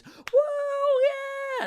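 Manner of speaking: high, loud whoop; cheering crowd. Acted. Crowd applauding the fishmonger's tale, whooping cheer. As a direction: cheer like a delighted audience. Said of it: "And the crowd goes".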